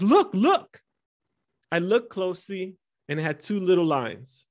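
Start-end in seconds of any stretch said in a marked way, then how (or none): 0.0-0.8 high, loud, thrilled urging; squealing with joy to show him; wife's delighted high look look; excited. Retold or imitated. Wife showing pregnancy test.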